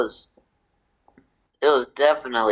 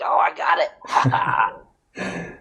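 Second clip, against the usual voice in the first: laughing mock grab; 3 semitones higher, much slower than usual. Grabbing a dollar bill.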